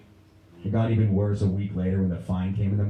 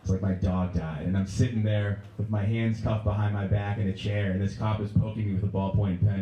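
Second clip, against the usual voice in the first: ordinary talk, nothing marked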